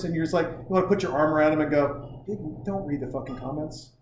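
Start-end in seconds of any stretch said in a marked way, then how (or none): 2.3-3.9 softer, lightly acted friendly advice; confidential aside. Friend advises an artist with an arm round them, confidential aside. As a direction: drop the voice as if taking them aside.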